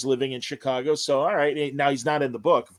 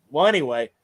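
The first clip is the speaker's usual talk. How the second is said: ordinary talk, nothing marked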